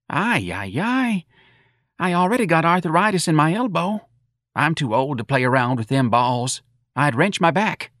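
high-pitched, folksy moaning; complaining older mother. Acted. Mrs. Riley excusing herself from bowling, plaintive. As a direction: moan about your aches in a high voice.

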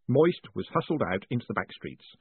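usual speaking voice

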